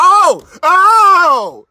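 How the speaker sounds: loud, high exclaimed oh; a big theatrical caught-you oh; mock outrage. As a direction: pounce on her words with a hammed-up gasp. Mocking the ex's backtracking tweet.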